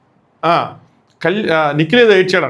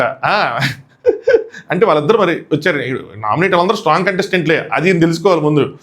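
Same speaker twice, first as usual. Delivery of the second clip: ordinary talk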